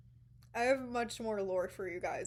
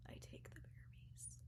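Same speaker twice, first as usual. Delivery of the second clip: whisper; 19 dB quieter, faster than usual. Real, not acted. Dramatic reveal of taking it.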